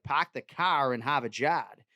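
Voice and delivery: "Park the car in Harvard Yard" in a slow, broad Boston accent, Rs dropped; slow Boston phrase demo. Shown as a demonstration. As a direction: classic Boston line read out slowly. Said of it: "it's like".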